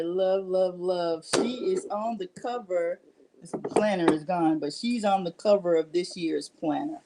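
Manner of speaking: ordinary delivery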